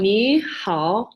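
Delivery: "Ni" sweeping up, "hao" dipping down and back; second and third tone. Shown as a demonstration. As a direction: exaggerate both tones of "Ni hao" for learners. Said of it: "is how you would say that".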